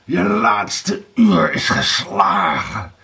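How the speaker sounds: slow, drawn-out villain's cry; shouting. A narrator's reading. Blauwbaard raises his sword at Erika, shouting. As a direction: proclaim her doom, stretching every word. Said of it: "schreeuwde hij".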